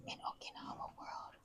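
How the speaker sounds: whispering in a cave; hushed voice echoing in cave. Real, not acted.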